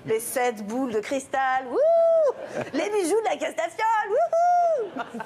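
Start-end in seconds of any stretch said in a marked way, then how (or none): 1.7-3.8 high squealing ouh of fake shock; a high teasing ouh; mock shocked squeal. Mock scandalized reaction. 4.1-5.2 high laughing woo-hoo; high giggling woo-hoo; teasing woo-hoo. Pretend. Mock scandalized reaction.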